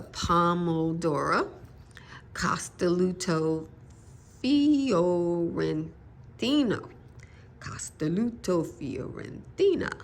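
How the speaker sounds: slow, drawn-out attempt at an Italian accent; amateur Italian accent, syllables stretched out; best Italian accent. Shown as a demonstration. Gardener introduces an heirloom tomato by name, best-attempt Italian accent. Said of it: "It's my best Italian accent".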